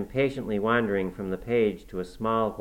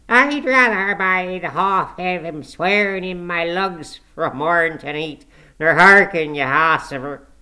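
put-on much louder and higher, slow, a cantankerous old Yorkshire grumbler; harsh scornful old servant; 9 semitones higher, 10 dB louder than usual. A narrator's reading. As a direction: scold her with harsh scorn. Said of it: "harsh words of scorn and intolerance".

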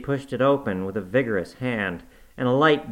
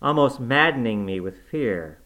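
ordinary delivery

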